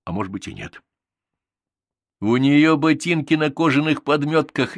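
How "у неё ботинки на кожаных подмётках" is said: high, loud and merry call; cheerful shout. A narrator's reading. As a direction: shout it out gleefully. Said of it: "весело крикнул Зван". (весёлый громкий выкрик)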